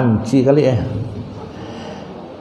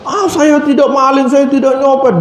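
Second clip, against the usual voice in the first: high-pitched innocent protest; pleading protest; 10 semitones higher than usual. Retold or imitated. (protes memelas bernada tinggi)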